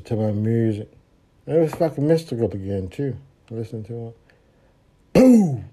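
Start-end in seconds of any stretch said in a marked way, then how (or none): 5.1-5.6 loud, high "Boom!"; sudden loud mock impact; crash sound. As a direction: shout the crash. Car crash in story.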